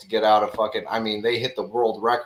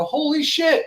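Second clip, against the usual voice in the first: very high mock gasp of shock; a high, hammed-up holy shit; stunned disbelief. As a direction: imitate someone floored by the news. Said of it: "they look me up and they go".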